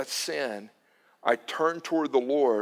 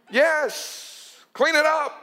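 high, stern command; voice of the Lord; 13 semitones higher, 4 dB louder, much slower than usual. Retold or imitated. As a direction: play God insisting firmly. Voicing the Lord's reply.

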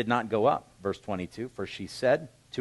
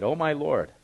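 Oh, my Lord pitched up and louder; earnest plea; 5 semitones higher, 5 dB louder than usual. Acted. Hannah pleading with Eli at the temple, earnest. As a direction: cry out to the priest. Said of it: "And she said".

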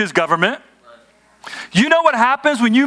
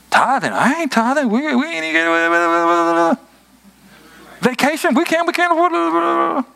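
mock worried spouse fretting over money; half-mocking fearful partner, pitch climbing; anxious spouse; 7 semitones higher than usual. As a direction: voice a penny-pinching spouse in a panic.

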